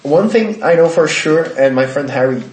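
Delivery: ordinary talk, nothing marked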